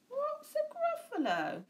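high puzzled owl. A narrator's reading. Owl asking the mouse what a Gruffalo is, high. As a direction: ask it high like a curious owl. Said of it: "An owl saw the mouse".

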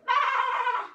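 high, loud wobbling baa; big bleating cry; lamb bleat. Pretend. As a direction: imitate a lamb for children. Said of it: "she makes a noise like this".

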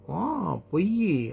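high, drawn-out whine of protest; childish protest. Acted. Boy accusing his father of lying, high drawn-out protest.

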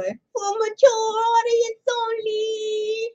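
high, slow mock announcer. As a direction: drawl it like a warning.

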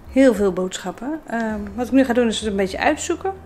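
normal talking voice

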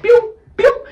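very high, loud pew sounds; pew pew effect. Retold or imitated. Throwing machetes, shrill pew pew. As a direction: fire off a high cartoon pew. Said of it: "grabbed their machetes and said".

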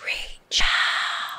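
slow eerie whisper; creepy whispering. Acted. Mermaid luring him, creepy whisper in his head. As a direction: breathe it like a voice inside his head. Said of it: "drop the creepy whispering in my head".

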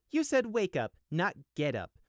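high, cheeky, pointed correction; smooth correction. Acted. Sheep correcting the guard: cheeky, smooth. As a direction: correct him coolly, a bit smug. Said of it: "corrected the Solar Guard smoothly".

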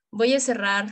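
ordinary delivery

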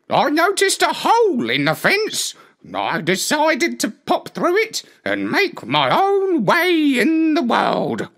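a fussy hen telling her tale. Acted. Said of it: "said the hen".

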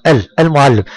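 المعلم with the L of ال sounded clearly; pronounced lam. Shown as a demonstration. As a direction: isolate ال, then say المعلم with its L. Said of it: "انطق معي". (إظهار اللام في المعلم)

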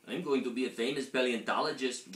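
ordinary delivery

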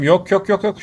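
frantic denial. Real, not acted. Gamer panicking as enemies rush the team, frantic repeated no. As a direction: panic as the enemy closes in.

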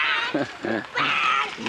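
short chuckle to oneself. Real, not acted. Amused at camera.

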